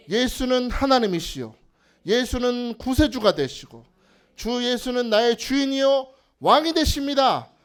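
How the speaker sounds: loud, pleading cry to Jesus; fervent cry. Acted. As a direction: cry out for mercy with full voice. Preacher voicing earnest plea prayer. (간절한 큰 부르짖음)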